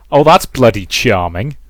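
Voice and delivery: usual speaking voice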